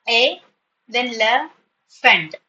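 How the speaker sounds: "A, then le, fent" as separate sounds with pauses; syllables in isolation. Shown as a demonstration. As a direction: give each piece of elephant alone: "A", "le", "fent". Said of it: "E alone is a syllable".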